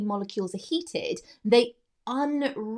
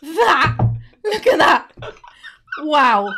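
loud, high, astonished exclamations; amazed; 5 semitones higher, 7 dB louder, much slower than usual. Real, not acted. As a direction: react in loud disbelief. Shocked by rubbery cake.

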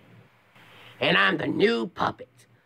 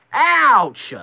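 yelp; 9 semitones higher, 6 dB louder than usual. Acted. Sudden pain in Sonic voice.

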